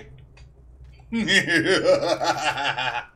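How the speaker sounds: big gleeful cackling laugh; loud cackle. Real, not acted. Laughing after kill.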